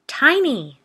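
loud high shriek. Acted. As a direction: shriek the dog's name in alarm. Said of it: "the woman shrieked".

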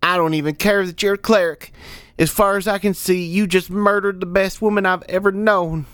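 put-on high, indignant young voice; defiant lad. Pouting lad blaming the cleric for murder, high and indignant. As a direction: accuse him hotly in a high voice.

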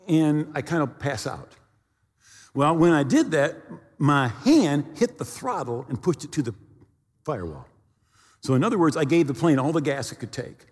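usual speaking voice

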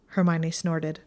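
usual speaking voice

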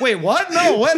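high-pitched, baffled double take; mock confusion. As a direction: act out rising bewilderment, pitch climbing. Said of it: "it's just this confusing".

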